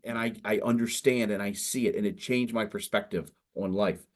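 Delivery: ordinary talk, nothing marked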